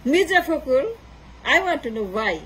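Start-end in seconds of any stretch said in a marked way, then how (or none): none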